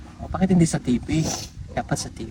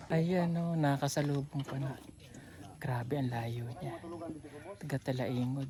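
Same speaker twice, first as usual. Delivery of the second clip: hushed voiceover, much softer; quiet; 7 semitones lower, 22 dB quieter than usual. Real, not acted. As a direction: murmur it close to the mic. Quiet voiceover closing the vlog.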